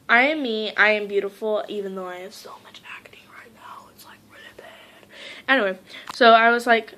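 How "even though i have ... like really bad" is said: quiet embarrassed whisper; whispered aside. Real, not acted. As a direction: drop to a whisper, sheepish. Embarrassed aside about acne.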